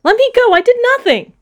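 Eunice crying out as she is arrested; loud protest. Acted. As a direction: shout your innocence while being arrested. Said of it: "Not as loud as Eunice".

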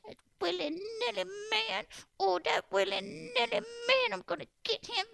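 put-on old woman grumbling about the man; fuming old woman. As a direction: whine it like a crotchety old lady.

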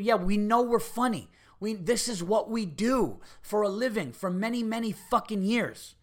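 high, exasperated comeback, drawn out at the end; indignant retort. Acted. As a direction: insist on it with heated pride. Acted comeback to drunk fan.

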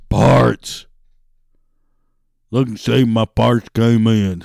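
loud, put-on customer voice; mock customer demanding loudly; caller's voice. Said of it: "I don't know why I'm using this voice".

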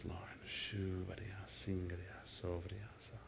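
soft prayer drifting into tongues; hushed worship prayer in tongues; murmured prayer. Acted. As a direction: pray under your breath, then murmur in tongues. Prays aloud in tongues.

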